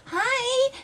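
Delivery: very high, held-out greeting; sweet-talking greeting. Retold or imitated. Retelling hello to bar strangers, high drawn-out greeting. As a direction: sing it out high, as a retold hello.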